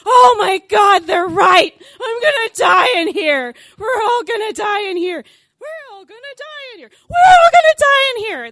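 high, panicked screaming near tears; panicked shout. Retold or imitated. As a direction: shout it like you truly think you'll die. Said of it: "That's what I'm thinking in my brain".

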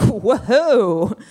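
long, high whoa of shock; mock-shocked exclamation. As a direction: draw out a scandalized whoa. Shocked reaction to swearing.

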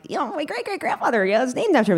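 high, softened mock-casual brag; high little show-off voice; coy boasting. Boasting to strangers in retelling.

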